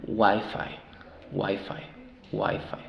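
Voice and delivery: "Wi-Fi" stressed on the first syllable. Shown as a demonstration. Teacher modelling word stress, WI-Fi with first syllable strong. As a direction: repeat "Wi-Fi" leaning on "Wi". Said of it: "la sílaba tónica es en la primera".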